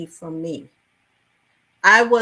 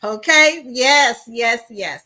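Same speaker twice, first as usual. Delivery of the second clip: high, loud string of affirmations; excited agreement; 4 semitones higher, 6 dB louder, slower than usual. Real, not acted. Affirming love of Caribbean food, excitedly emphatic. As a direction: exclaim it high, settling as it goes.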